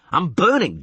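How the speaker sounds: loud pained cry; pained shout. Acted. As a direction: cry out while burning. Geralt caught in burning web.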